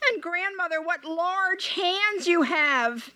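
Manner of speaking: put-on high, slow, wondering girl; a child's uneasy wonder; high hesitant girl's voice; little girl voice.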